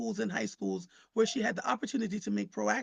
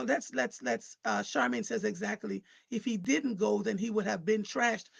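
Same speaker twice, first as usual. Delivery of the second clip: ordinary talk, nothing marked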